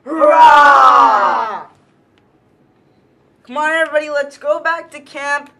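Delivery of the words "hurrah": big triumphant shout; loud cheer. Acted. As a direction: cheer it out for the group. Cheer after hunt.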